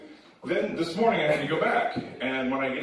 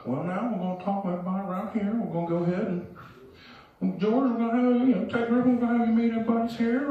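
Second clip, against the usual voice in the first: put-on higher-pitched Southern old-timer; older Southern gentleman; 6 semitones higher than usual. Director introducing the group, in an older Southern voice. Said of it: "an older Southern gentleman".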